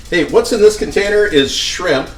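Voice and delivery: ordinary talk, nothing marked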